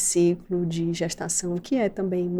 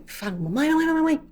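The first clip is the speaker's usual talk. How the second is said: put-on high whiny kid calling mom; whining child; 8 semitones higher, faster than usual. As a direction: whine like an impatient little girl.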